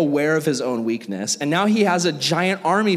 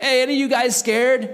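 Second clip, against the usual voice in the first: higher, louder, breezy call to the troops; casual Gideon calling out; 9 semitones higher, 4 dB louder than usual. Retold or imitated. Gideon calls to his army, a light higher mock shout. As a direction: toss the question out to the crowd.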